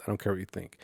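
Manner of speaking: whispered, unbothered aside; hushed shrug to the audience; defiant whisper. Real, not acted. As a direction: drop to a breathy whisper, like telling a secret.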